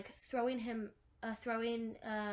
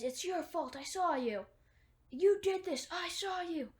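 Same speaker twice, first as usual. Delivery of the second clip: higher, frantic accusation, acted out; freaking out; 6 semitones higher, 4 dB louder than usual. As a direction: imitate someone losing it, building louder. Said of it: "Ben just freaks out".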